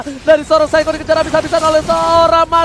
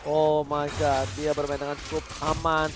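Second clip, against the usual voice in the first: quiet commentary; 9 semitones lower, 11 dB quieter than usual. Real, not acted. Quiet calm analysis stretch.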